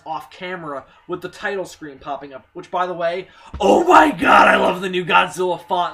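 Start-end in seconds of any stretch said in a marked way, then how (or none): 3.6-5.9 loud burst of real excitement, voice cracking; excited shout. Real, not acted. Fan gushing to viewers about new Godzilla logo, shouting excitedly. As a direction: blurt it out, thrilled.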